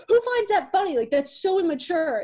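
high, loud mocking voice of the ex; mock whiny ex. Mocking her ex's complaint in a high loud voice. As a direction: mimic him, pitched high and whiny. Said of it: "He was like".

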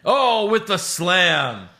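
very slow, high, loud mock commentator; Michael Cole call. Still mocking the commentator for his co-host, drawn-out announcer voice. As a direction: drag it out like a TV announcer savouring the move. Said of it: "as Michael Cole called them".

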